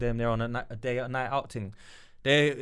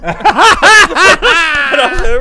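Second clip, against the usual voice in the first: breaking up with laughter, much louder; laughing; 10 semitones higher, 12 dB louder than usual. Real, not acted. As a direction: laugh out the words. Laughing mid-story.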